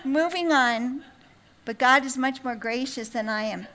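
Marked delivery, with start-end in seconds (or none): 0.0-1.0 raised, emphatic cut-off; mock dismissive. Potter to a mouthy pot, mock dismissive and final. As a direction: shut the back talk down firmly, slowing on the last words.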